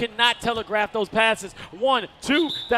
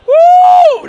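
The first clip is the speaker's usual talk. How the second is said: a sudden, very high and loud whoop; excited whoop; 20 dB louder than usual. Real, not acted. Commentator cheering a comeback with a whoop. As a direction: cheer out as the score swings.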